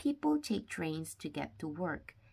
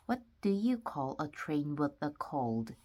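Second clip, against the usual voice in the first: ordinary delivery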